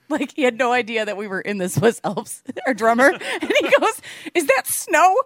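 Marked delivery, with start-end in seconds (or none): 4.3-5.1 high, slow, baffled; sleepy confusion, laughing; confused groggy voice. Retold or imitated. Drummer waking confused.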